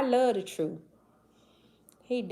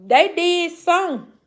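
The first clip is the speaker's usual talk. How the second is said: higher, louder and slowed right down on they did some; high emphatic drawl; 9 semitones higher, 8 dB louder, slower than usual. Real, not acted. Reacting to a chat comment with a high, slow, loud drawl. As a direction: drag out they did some like you can't believe it.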